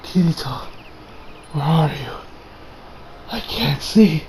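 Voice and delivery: soft, faint retold plea; hushed and halting; dying character's weak words. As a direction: quote it softly, weakly, with gaps. Voices dying Yujo.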